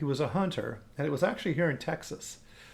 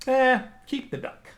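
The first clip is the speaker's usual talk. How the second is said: dismissive shrug; 8 semitones higher, 3 dB louder than usual. Retold or imitated. Farmer gives up, dismissive.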